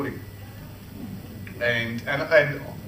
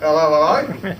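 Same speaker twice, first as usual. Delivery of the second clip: louder greeting blurted into the room; 3 semitones higher, 7 dB louder than usual. Retold or imitated. Employee greets the Queen's entourage out loud, louder. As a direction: let it out, not to yourself. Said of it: "I didn't say hello, hello to myself".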